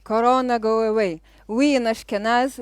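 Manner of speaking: normal talking voice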